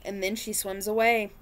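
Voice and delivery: lively picture-book read-aloud; reading to children. A narrator's reading. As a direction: read it like storytime for little ones.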